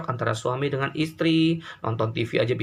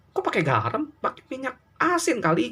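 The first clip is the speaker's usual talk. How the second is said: wife's mock retort; 11 semitones higher than usual. Wife retorts in retold quarrel.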